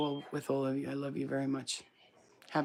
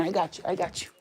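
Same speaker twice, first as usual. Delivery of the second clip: ordinary delivery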